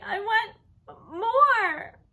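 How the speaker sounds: put-on crying toddler. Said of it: "Fudge started to cry".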